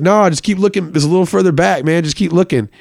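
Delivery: a high, smooth "keep looking"; coaxing dealer. Retold or imitated. Dealer coaxing, gun at hand.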